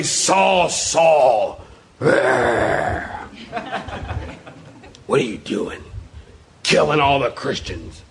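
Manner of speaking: harsh put-on scolding, dropping low; mock-thunderous accusing voice; wrathful God. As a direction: growl the rebuke, hammed up. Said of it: "I don't hear that".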